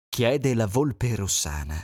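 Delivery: usual speaking voice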